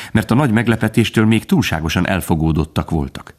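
ordinary delivery, nothing marked

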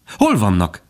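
usual speaking voice

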